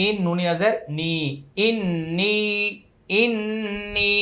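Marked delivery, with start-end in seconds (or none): 1.7-4.1 nasal hum held on the doubled n of "ইন্নি"; ghunnah, then long stretch. Shown as a demonstration. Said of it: "প্রথমে ওয়াজিব গুন্না". ("ইন্নি" গুন্না করে, শেষে টেনে)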